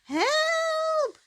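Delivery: very high, loud squeaky shriek; tiny mouse shrieking for help at the cat. A narrator's reading. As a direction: scream for help with all she has. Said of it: "shrieked Miss Tiny at the top of her voice".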